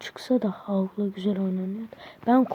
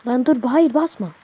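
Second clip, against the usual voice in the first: louder, higher "no, don't press"; alarmed shout; 3 semitones higher, 7 dB louder than usual. Real, not acted. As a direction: yell to stop him in time. Teammate about to press something. (telaşla bağırarak)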